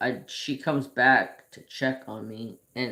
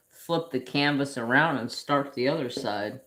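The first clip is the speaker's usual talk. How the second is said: ordinary talk, nothing marked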